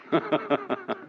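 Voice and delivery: short run of even chuckles. Real, not acted. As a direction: chuckle after a big relieved breath. Amused after demonstrating relief.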